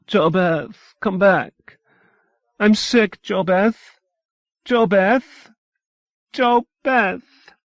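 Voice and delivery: loud, high pleading call; calling after her. A narrator's reading. Sick mother calls her daughter back down the stairs, loud and high. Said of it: "called after her".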